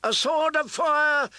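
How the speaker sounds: high, loud chanting shout; crowd cry. A narrator's reading. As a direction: roar it as one of the crowd. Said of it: "took up the cry".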